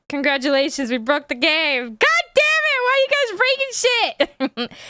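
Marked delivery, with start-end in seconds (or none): 1.8-4.8 high-pitched comic scolding; mock outrage. Scene: streamer blaming chat for breaking the game, mock fury. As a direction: squeal in hammed-up exasperation.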